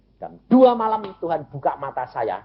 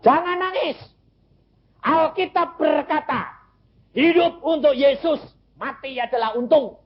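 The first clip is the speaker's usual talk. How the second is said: high, ringing preacher's proclamation; retold exhortation. Father to weeping family, do not cry, high firm exhortation. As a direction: declare scripture in a raised, high pitch.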